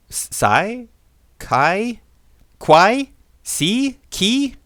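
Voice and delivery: trying Sai, Kai, Kwai, Si, Ki high and unsure; guessing a name. Shown as a demonstration. Puzzled guesses at the son's name, rising. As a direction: sound out each guess as a puzzled question. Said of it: "learn how to spell in English".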